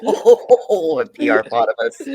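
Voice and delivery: loud laugh breaking into the words. Real, not acted. Delighted at co-host's silly coinage, laughing loudly. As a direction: crack up while repeating the joke.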